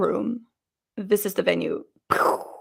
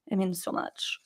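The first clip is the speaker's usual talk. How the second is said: "It means so much" repeated almost silently, much softer; soft echo; 3 semitones lower, 15 dB quieter than usual. Shown as a demonstration.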